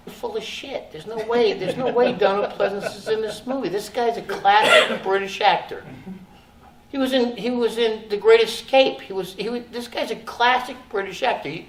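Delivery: high, scornful disbelief; scoffing disbelief. Retold or imitated. Retold scoffing inner voice.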